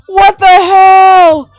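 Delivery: high, loud, drawn-out disbelief; real high-pitched astonishment; amazed shout. Real, not acted. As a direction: shout it slowly, thrilled and stunned. Amazed at reaching the goal.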